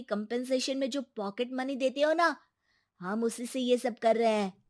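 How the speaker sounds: put-on controlled, reproachful young son; defiant son. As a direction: answer father back without shouting.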